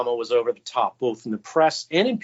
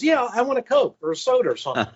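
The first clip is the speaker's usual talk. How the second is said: imitating a casual kid, higher and fast; 8 semitones higher, faster than usual. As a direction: toss it off like a kid asking for a soda. Kid orders a soda.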